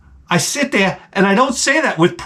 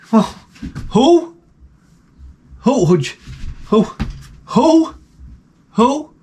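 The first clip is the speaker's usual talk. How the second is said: 1 mock confused who; 3 semitones higher than usual. Rebound guy forgetting his ex.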